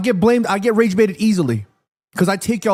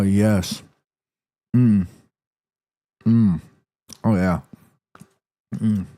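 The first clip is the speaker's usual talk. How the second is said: mock savoring moans; 9 semitones lower than usual. Streamer pretending to enjoy a choco pie for chat, deep mock moans. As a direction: ham up tasting something delicious.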